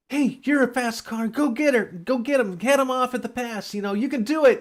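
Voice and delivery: high, loud pep talk; mock cheering friends. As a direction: urge the car to chase. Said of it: "they're like".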